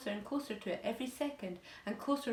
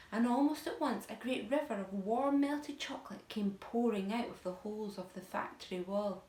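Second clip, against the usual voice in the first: ordinary delivery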